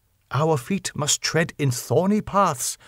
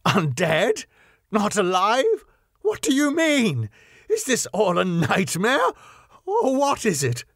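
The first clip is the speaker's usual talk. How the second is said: high, strained questions; horrified disbelief; 9 semitones higher than usual. A narrator's reading. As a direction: reach for it in growing panic.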